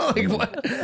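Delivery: cracking up in disbelief; high burst of laughter. Real, not acted. Laughing at the prank phrase's real meaning.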